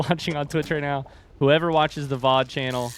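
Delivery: ordinary talk, nothing marked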